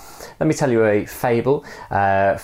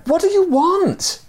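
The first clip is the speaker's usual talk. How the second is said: high, slowed mock yell; a high, exasperated call over the edge; storytelling yell; 3 dB louder than usual. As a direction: shout the question down to him. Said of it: "yelled to him again".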